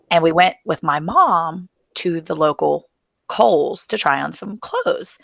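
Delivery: usual speaking voice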